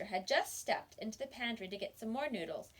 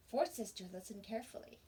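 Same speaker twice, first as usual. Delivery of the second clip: plain reading voice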